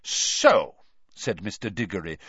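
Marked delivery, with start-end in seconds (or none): 0.0-0.8 a touch of gloating triumph; savage triumph. A narrator's reading. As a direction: land it with grim satisfaction. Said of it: "with a kind of savage triumph".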